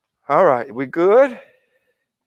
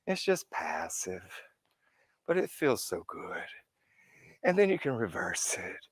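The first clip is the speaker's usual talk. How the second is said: breathy hushed whisper; soft whispered guidance; 13 dB quieter than usual. Real, not acted. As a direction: drop to a whisper to soothe. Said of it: "soft guided feel".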